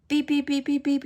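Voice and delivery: high, rapid-fire string of beeps; imitated phone beeps. Phone alerts as data comes back on, high mimicked beeping. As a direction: mimic an alert going off, pitched well up.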